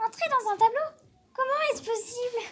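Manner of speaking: put-on high, loud amazed little-boy voice; astonished boy. A narrator's reading. Boy stunned by the portrait's idea, high and astonished. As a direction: gasp it out in disbelief.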